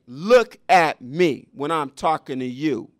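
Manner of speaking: loud, slow teacher's rebuke; loud slow classroom reprimand; stern scolding. Retold or imitated. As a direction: scold the student, every word hammered.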